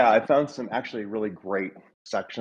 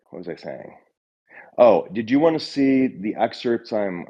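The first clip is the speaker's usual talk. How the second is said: ordinary talk, nothing marked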